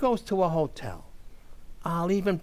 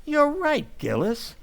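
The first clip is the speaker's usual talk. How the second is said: ordinary delivery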